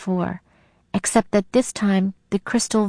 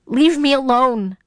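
higher, pleading cry; distressed plea, pitched up; begging; 5 semitones higher than usual. A narrator's reading. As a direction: beg to be left alone. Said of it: "I begged".

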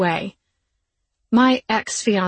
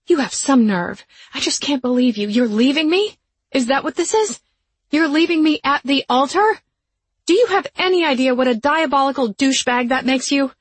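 loud, high outraged yelling; furious rant; 6 semitones higher, 5 dB louder than usual. A narrator's reading. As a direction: tear into him, voice rising. Bride ranting at jilting fiancé.

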